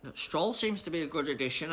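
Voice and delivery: normal talking voice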